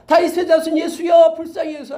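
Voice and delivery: high, loud desperate cry; pleading shout. Retold or imitated. Blind beggar shouting to Jesus over the crowd, pleading cry. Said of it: "꾸짖었다라는 거예요".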